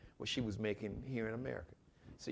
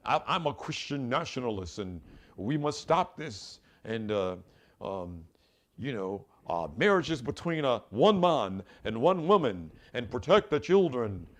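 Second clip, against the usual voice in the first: light mock Hungarian accent; 7 dB louder than usual. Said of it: "with this funny ass acc-, accent".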